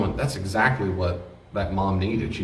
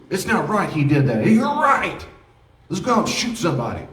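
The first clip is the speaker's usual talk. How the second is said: mock outrage, pitch jumping high; acted anger; 10 semitones higher, 5 dB louder than usual. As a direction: play a riled-up friend egging them on. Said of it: "if they're mad, I gotta be mad too".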